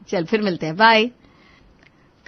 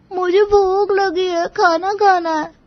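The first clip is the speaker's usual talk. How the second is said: put-on whiny child; 10 semitones higher, 3 dB louder, slower than usual. Hungry child Pinki whines.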